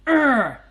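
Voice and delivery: loud, high groan of frustration; frustrated growl. Real, not acted. Streamer venting at the telco's upload cap, frustrated groan. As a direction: let out an exasperated "urgh".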